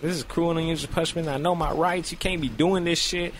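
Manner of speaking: hammed-up pained outrage; pained protest. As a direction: protest as if in pain. Retold protest during painful exam.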